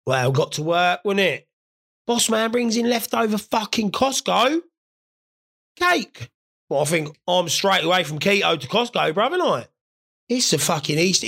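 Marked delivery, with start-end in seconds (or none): 2.1-6.3 excited. Real, not acted. Excited reveal of cake.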